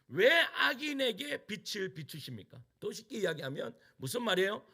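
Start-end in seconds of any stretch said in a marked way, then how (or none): 0.1-2.6 "왜 악인에게 빛을 비추십니까" drawn out, each word weighed; slower repeat. Acted. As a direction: repeat "왜" slowly and deliberately. ("왜 악인에게 빛을 비추십니까"를 천천히 힘주어)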